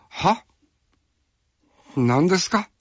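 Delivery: high, baffled "huh?"; puzzled surprise. Retold or imitated. Fellow actors baffled by the unfamiliar dialect line, surprised. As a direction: sound completely thrown by a line you don't get. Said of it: "みたいなな、顔".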